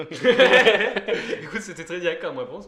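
high loud laughing voice. Real, not acted. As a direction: burst out laughing while talking. Laughing at own blunt answer. (en riant fort)

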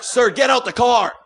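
mock border guard shout; officer yelling. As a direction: bark the order at him. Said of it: "He's like".

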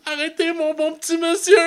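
very high squeaky plea; mock pleading. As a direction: beg in a shrill put-on voice. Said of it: "T'es comme".

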